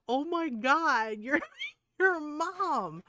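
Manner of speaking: high, drawn-out mock disbelief; amused, scandalized friend voice; friends cracking up. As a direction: imitate incredulous friends, stretched out and high. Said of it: "my friends are cracking up about this".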